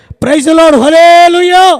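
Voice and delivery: booming praise shout. Real, not acted. Preacher roars praise.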